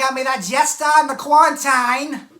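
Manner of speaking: loud put-on Boston accent; Boston accent attempt. Shown as a demonstration. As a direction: ham up a Boston guy. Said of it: "I'm just a Southern boy".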